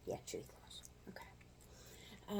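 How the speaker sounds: hushed whisper. Real, not acted. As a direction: whisper it off to the side. Whispered aside.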